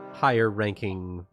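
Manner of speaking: usual speaking voice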